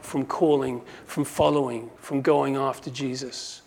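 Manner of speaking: usual speaking voice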